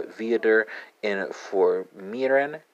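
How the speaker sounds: "informieren" said slowly in German; slow German demo. Shown as a demonstration. Teacher slowly modelling "informieren" for German learners. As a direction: sound out "Viader informieren" carefully. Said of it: "let's go from Dutch to German".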